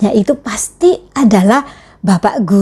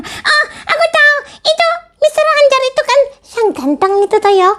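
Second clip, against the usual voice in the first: put-on much higher childlike puppet voice; puppet friend; faster than usual. Teacher voicing her puppet for the children, high puppet voice. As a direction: play the cheeky puppet sidekick, pitched way up. Puppet gushes about Mister Hanjar.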